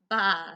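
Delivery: loud sheep bleat; mock baa. Sheep answering Duck with a baa.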